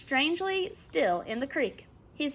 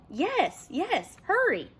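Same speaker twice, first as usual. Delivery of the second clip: urgent reassurance; 7 semitones higher, 3 dB louder, slower than usual. A narrator's reading. Chet rescuing Sid.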